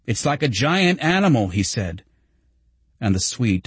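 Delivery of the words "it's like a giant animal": higher, excited exclamation. A narrator's reading. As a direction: marvel at the crowd with lifted pitch. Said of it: "The roar of the crowd excited him".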